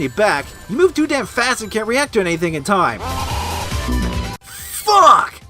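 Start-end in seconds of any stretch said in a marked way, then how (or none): 4.4-5.4 sudden furious yell; raging gamer. Real, not acted. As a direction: explode at the screen. Rage at dying in game.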